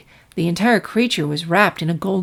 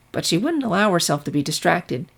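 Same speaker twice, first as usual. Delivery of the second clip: ordinary delivery, nothing marked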